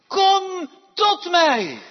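loud, high, slow call. Shown as a demonstration. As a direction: call across a great distance. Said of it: "Hij roept".

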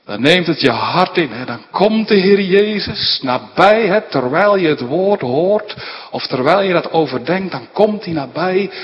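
loud, high, impassioned sermon voice; preaching at full force. Acted. Preacher urging his congregation, fervent full-voiced preaching. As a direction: build to a fervent preaching peak.

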